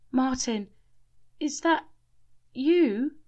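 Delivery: high, rising wonder; hopeful wonder. A narrator's reading. Shelley's spirit seeing Martin, high and hopeful. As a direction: lift with hope. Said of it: "her spirit lifting".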